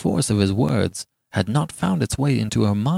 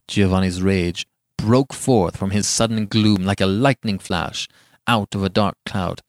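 usual speaking voice

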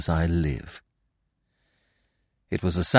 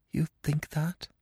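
high, very soft woman's voice; small, hushed question; whispered; 8 semitones higher, 9 dB quieter than usual. A narrator's reading. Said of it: "she whispered".

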